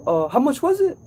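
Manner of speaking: high, put-on lady's voice asking the price; customer voice. Customer asking the repairman the price, high put-on voice. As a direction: play the lady feigning innocence. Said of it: "She said".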